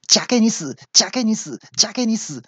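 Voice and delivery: very high, repeated mechanical chant; elevator voice. Retold or imitated. Elevator announcement keeps squeezing the man, mock automated voice. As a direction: mimic a spiteful automated announcement. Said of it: "电梯那个语音".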